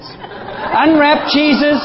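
slow, laughing "Unwrap Jesus"; slow slogan. Shown as a demonstration. Preacher trying out a slogan on the congregation, slowly. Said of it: "How's that?".

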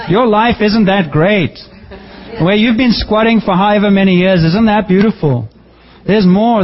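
preaching up a notch to a complacent church. Acted. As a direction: press the point home to the congregation. Preaching with rising force.

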